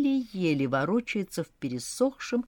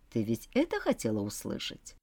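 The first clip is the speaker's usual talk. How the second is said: smirking; 7 semitones lower, 6 dB quieter than usual. A narrator's reading. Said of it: "усмехнулся он".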